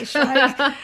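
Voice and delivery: punchy put-on German accent; mock German. Host echoes the German-sounding bird name, mock German. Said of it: "sounds so German".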